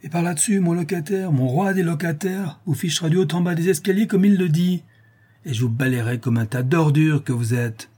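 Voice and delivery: high-pitched indignant tirade, settling at the end; feisty portress telling them off; scolding concierge. A narrator's reading. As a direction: rant proudly and threateningly. Concierge carries on her furious threat.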